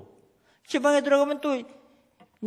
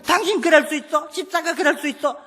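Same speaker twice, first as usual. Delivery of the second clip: higher, accusing challenge to the husband; nagging accusation; 6 semitones higher than usual. Retold or imitated. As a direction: needle him like a nagging wife. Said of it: "따지지 좀 마로".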